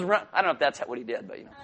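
normal talking voice

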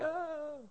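high, soft wavering "ah"; mock tormented high moan; distressed groan. Struggling alcoholic faced with a beer, high soft moan.

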